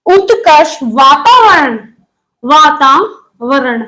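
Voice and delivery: वातावरण drawn out slowly for students to note; a teacher spelling out a term; slow dictation. Shown as a demonstration. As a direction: dictate each word slowly.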